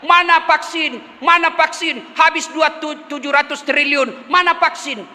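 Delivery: high-pitched imitation of complaining netizens; insistent retold yelling; mock crowd clamour. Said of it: "rakyat kita teriak".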